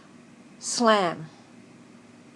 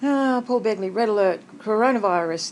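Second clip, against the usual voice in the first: ordinary delivery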